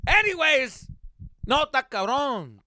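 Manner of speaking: loud, high comic exclamation; paisa catchphrase. Retold or imitated. Humoring a venting neighbor with stock phrase, loud. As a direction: belt out the stock sympathetic reply.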